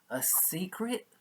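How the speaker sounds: high, soft whispered wonder; curious whisper. Acted. Young raccoon asks mom about the secret, high curious whisper. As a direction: breathe it like a curious little kid. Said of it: "he asked his mom".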